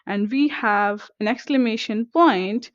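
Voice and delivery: usual speaking voice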